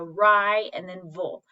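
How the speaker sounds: ri and then vul, each part said slowly and apart; syllables split apart. Shown as a demonstration. Teacher splits rival into syllables for students, slow and clear. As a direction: break rival into its two syllables. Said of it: "I'm hearing ri".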